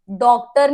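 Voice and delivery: डॉक्टर with gh sounded as a hard stop; wrong pronunciation. Shown as a demonstration. Teacher showing what not to say, डॉक्टर with gh sounded. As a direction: sound a consonant before t in डॉक्टर. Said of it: "डॉक्टर नहीं बोलते".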